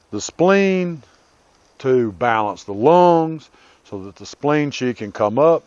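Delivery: ordinary talk, nothing marked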